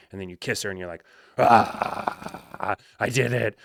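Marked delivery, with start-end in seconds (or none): 1.4-3.6 louder, breathy triumph; elated relief. Retold or imitated. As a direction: let it burst out after the kiss. Client after first kiss.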